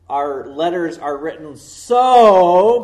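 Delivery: so said loud and slow, as the wrong sense; stressed so. Shown as a demonstration. Pastor showing the reading he rejects, loud so. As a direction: punch the word so, drawn out. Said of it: "we're not saying".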